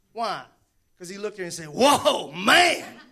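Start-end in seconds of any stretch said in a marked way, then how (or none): 1.7-3.0 stunned by her; amazed whoa. Pretend. Man gasping in awe at the woman.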